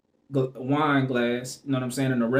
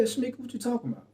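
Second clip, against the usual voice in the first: higher, fast, baffled retold question; retold confusion; 8 semitones higher, 4 dB quieter, faster than usual. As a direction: replay not getting why she's mad. Confused at being thrown out.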